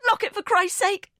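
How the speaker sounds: desperate yell. A narrator's reading. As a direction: shout it at her, frantic. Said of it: "I yell".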